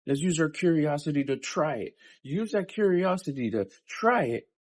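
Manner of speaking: a conscience urging you to get up and try; inner pep talk. Retold or imitated. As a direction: pump yourself up like a coach. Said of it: "When my conscience is saying".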